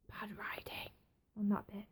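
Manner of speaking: a quick hushed dig at the writers; whispered aside. Real, not acted. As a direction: whisper it under your breath. Aside criticising the show.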